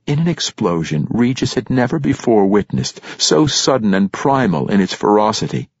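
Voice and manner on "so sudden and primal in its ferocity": usual speaking voice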